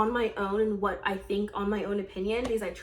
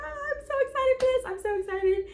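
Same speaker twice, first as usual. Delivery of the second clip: much higher, tearful and fast; happy crying; faster than usual. Retold or imitated. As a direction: sob with joy through the words. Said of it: "I literally shed a tear".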